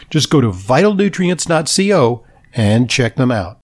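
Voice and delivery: upbeat sponsor announcement voice; ad read. Acted. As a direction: read it like a polished radio ad.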